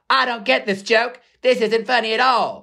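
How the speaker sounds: high mocking whine of a joke-missing viewer; humourless viewer. Mocking middle America missing the joke, high voice.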